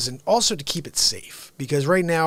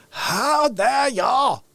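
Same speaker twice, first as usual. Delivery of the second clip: slow mock outrage; 6 semitones higher, 3 dB louder, much slower than usual.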